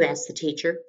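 usual speaking voice

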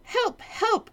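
high frantic cry for help; panicked page cry. Acted. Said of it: "cried the page".

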